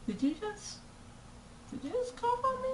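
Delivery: high, soft mock disbelief; appalled disbelief. Student to the cougher behind her, high appalled question. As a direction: ask it high and small, stopping to restart. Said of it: "I'm just sitting there like".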